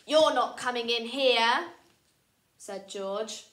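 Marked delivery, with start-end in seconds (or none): 0.1-1.7 loud, bossy no; bossy refusal. Acted. George refusing Luther entry, loud and bossy. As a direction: block him off.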